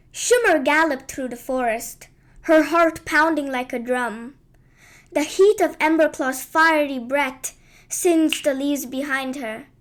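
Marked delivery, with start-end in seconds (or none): none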